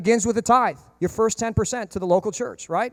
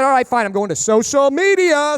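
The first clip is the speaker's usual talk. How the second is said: louder, slow, pointed threat; mock threat; 6 semitones higher, 6 dB louder than usual. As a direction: threaten them deliberately. Threatening the company.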